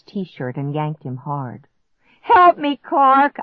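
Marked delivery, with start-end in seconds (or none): 2.2-3.4 high, very loud cry for help; desperate scream. A narrator's reading. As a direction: scream for help to move him. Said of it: "I screamed".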